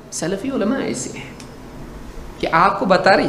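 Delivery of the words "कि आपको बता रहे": normal talking voice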